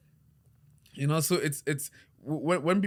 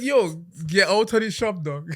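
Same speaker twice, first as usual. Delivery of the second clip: louder, higher retold order; indignant raised voice; 4 semitones higher, 5 dB louder than usual. Barber retelling how he ejected the client, indignant loud order. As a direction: throw him out, half amused.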